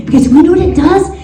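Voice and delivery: ordinary delivery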